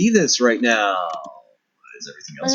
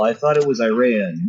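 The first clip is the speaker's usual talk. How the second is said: ordinary talk, nothing marked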